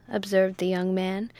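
ordinary delivery

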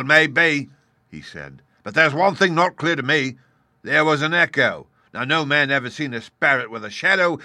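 high, loud, scornful pirate; scoffing pirate captain. A narrator's reading. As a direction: scoff at the ghost. Silver scoffs at the spirit.

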